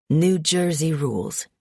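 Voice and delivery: ordinary delivery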